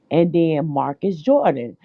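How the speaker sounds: normal talking voice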